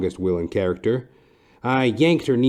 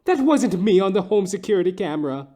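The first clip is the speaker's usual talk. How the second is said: high, pleading woman's voice; 9 semitones higher than usual. Acted. A frantic wife denies it to the detective, high pleading voice. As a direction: deny it, high and desperate. Same line later by other voice.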